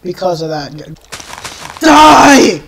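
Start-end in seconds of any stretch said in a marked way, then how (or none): 1.8-2.6 short, loud burst; angry shout. Real, not acted. Player lashing out at monster, angry shout. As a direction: shout it as you strike. Attacking in game.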